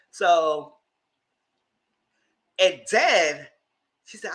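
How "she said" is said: usual speaking voice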